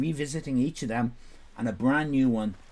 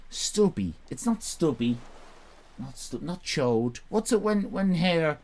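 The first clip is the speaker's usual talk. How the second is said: ordinary delivery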